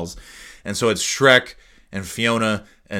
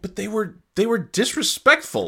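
lightly raised, pleading voice; whiny protest; 9 semitones higher than usual. Retold or imitated. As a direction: plead Shrek's case. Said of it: "I'm like".

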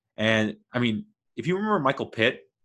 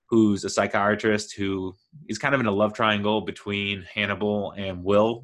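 ordinary talk

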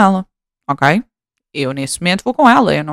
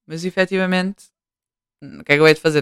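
ordinary delivery

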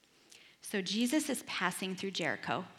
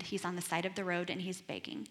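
ordinary talk, nothing marked

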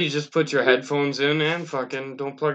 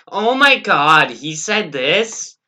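slow, higher mock phone gossip; imitating a gossiping woman; mock gossipy woman; 4 semitones higher, 5 dB louder, slower than usual. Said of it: "talk on their cell phone are like".

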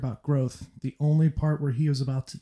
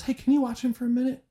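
sweet mom voice; 11 semitones higher, faster than usual. Retold or imitated. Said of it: "She goes".

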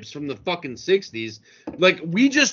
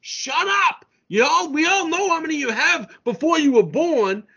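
higher-pitched, heated argument; exasperated; 7 semitones higher than usual. Real, not acted. Host arguing with Yankees fan about old titles, exasperated. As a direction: cut the fan off in high exasperation.

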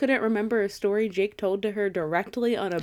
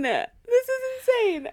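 squealed repeat; slower than usual. Real, not acted. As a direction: say it again, still squealing. Repeats the reaction.

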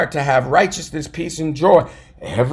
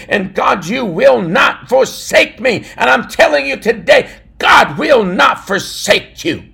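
loud, rising preacher's cry; fiery preaching; 4 semitones higher, 4 dB louder than usual. Acted. Pastor preaching to his listeners, building to a shout. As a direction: preach it with full force to the congregation.

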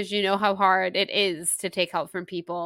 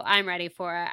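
usual speaking voice

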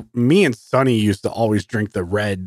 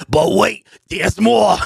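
loud, high infomercial pitchman shout; Billy Mays; 9 semitones higher, 3 dB louder than usual. Retold or imitated. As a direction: sell it like a TV pitchman. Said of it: "we were doing Billy Mays commercials".

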